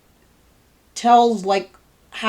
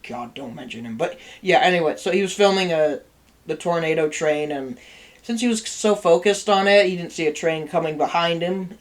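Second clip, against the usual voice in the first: ordinary talk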